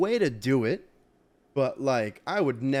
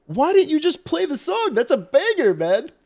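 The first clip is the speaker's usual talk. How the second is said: high, loud mock outrage; imitated incredulous bartenders; 13 semitones higher, 8 dB louder than usual. Bartenders scolding their coworker over the song, imitated incredulous yelling. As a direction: yell it high like baffled onlookers. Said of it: "all the bartenders look at this guy like".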